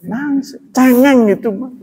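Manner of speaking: high teasing jab at crying; mocking scorn. Preacher teases the audience about crying, high and mocking.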